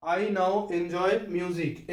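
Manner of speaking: "enjoy" said very slowly, word by word; slow example sentence. Shown as a demonstration. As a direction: dictate "I now enjoy music" at a crawl. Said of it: "एंजॉय वाला ओए लगा है". (बहुत धीरे उदाहरण वाक्य)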